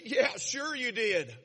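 slow, drawn-out mock "sure you did"; sarcastic disbelief. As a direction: scoff at the little brother's story. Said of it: "I'd say".

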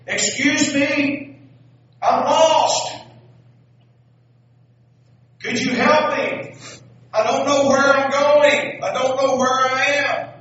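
lost balloonist calling down loudly, high and slow. Acted. Balloonist yelling down to the lady below, lost and loud. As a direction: shout down from the balloon, high and pleading.

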